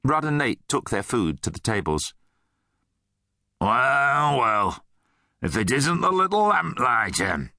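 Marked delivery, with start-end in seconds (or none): 3.6-7.5 gruff bully, drawn-out and pitched up. A narrator's reading. Mine guard taunts Nate at breakfast, gruff. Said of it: "came a gruff voice".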